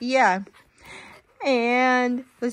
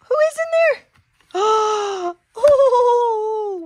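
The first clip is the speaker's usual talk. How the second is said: excited suspense; 8 semitones higher, 5 dB louder, slower than usual. Acted. Building suspense before horse.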